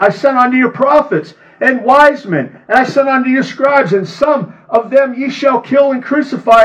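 high, ringing sermon voice; fervent scripture reading. Acted. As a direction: preach it at full force. Reads scripture with preaching force.

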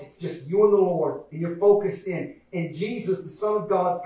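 normal talking voice

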